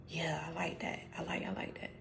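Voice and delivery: breathy whisper under the breath; a private thrilled whisper to yourself; inner whisper. Retold or imitated. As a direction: whisper it to yourself, barely audible. Private inner reaction, quoted.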